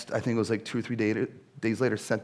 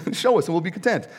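high, louder, a touch of clueless whine; naive Philip; 7 semitones higher, 4 dB louder, faster than usual. Acted. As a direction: play Philip as not getting it at all, in a mock-naive tone. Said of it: "Philip does not understand anything".

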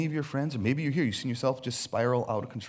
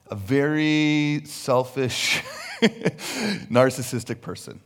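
laughing; 5 dB louder, much slower than usual. Real, not acted. Laughs over word choice.

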